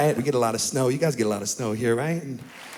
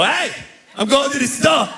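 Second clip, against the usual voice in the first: higher, louder Italian "Hey" up front; Italian family voice; 11 semitones higher, 4 dB louder than usual. Retold or imitated. Italian family calling out "Hey" first. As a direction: belt it out like an Italian uncle. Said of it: "We go".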